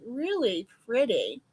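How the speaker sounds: ordinary delivery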